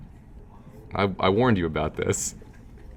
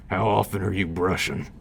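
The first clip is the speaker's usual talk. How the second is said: quicker, brighter take on the bear line. Shown as a demonstration. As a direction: say it back as the bear would. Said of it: "That's the bear voice".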